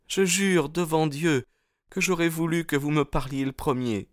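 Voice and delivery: put-on high young woman's voice, understated sob; high-pitched girl, mildly distressed; Etty, tearful. A narrator's reading. Young woman swears she wishes he'd spoken first, high tearful voice. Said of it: "sanglota-t-elle".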